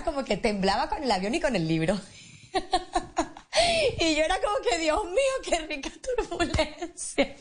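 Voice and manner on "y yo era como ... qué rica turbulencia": laughing. Real, not acted. Confessing she enjoyed the turbulence, laughing. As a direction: laugh through it, relishing the memory.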